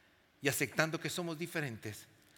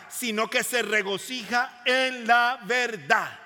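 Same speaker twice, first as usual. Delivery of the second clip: loud, higher, slow drawn-out preaching; full-force preaching; 6 semitones higher, 12 dB louder, slower than usual. Real, not acted. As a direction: proclaim each line to the whole congregation.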